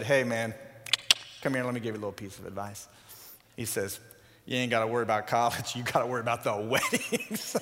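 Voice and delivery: lightly voiced deep-toned friend confiding; wise old friend. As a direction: play the seasoned buddy dishing out wisdom. Older friend giving advice.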